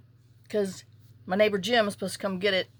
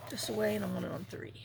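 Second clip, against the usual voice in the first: whispering; 8 dB quieter, faster than usual. Real, not acted. Crafting aside while cutting.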